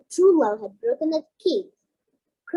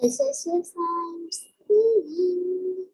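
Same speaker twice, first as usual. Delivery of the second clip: normal talking voice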